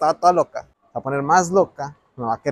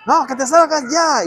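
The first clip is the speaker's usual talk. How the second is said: higher-pitched mock outburst; retold angry order; 8 semitones higher than usual. Landlady ordering her guest out at night, mock angry. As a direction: imitate her insisting, voice jumping up. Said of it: "me ve toda enojada y me dice".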